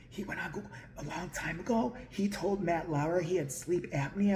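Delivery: very soft, confidential undertone; low-volume stage aside; hushed aside. Pretend. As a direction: let them in on the secret quietly. Hushed aside exposing the trick.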